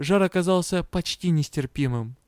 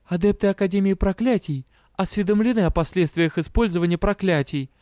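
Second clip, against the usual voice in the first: rattling it off like a memorized lesson; reciting a learned rule, a little higher; recited by rote; 5 semitones higher than usual. A narrator's reading. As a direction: schoolgirl reciting by heart. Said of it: "заучено пояснила я".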